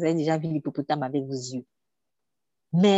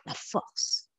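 whisper; 9 dB quieter than usual. Real, not acted. Sermon line drops to whisper.

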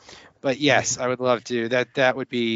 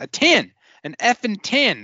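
Billy Bob quote; 9 semitones higher, 3 dB louder than usual. Retold or imitated. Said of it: "last time I quoted Billy Bob".

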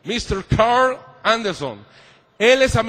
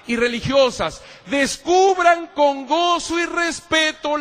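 solemn reading; 5 semitones higher, slower than usual. Acted. Reading Council text to nuns.

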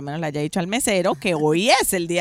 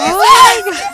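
very high, loud surprised gasp; delighted gasp; 15 dB louder than usual. Retold or imitated. Hearing the pianist's special message for her, a loud high gasp. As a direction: gasp like the surprise just hit you.

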